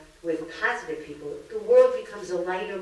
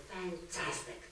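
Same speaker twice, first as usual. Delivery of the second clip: "Fantastic." much softer, with a falling tune; dry fantastic; 14 dB quieter, slower than usual. Retold or imitated. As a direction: murmur it politely flat, hiding disdain. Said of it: "So the third lady says".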